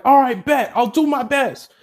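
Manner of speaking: eager sure-thing reply; Kirishima agrees. Acted.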